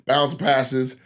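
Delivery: ordinary talk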